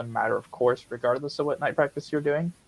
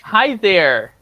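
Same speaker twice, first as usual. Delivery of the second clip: cheery greeting; 7 semitones higher, 10 dB louder than usual. Real, not acted. Greeting a new viewer.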